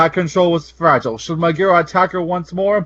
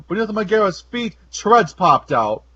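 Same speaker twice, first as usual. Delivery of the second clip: ordinary delivery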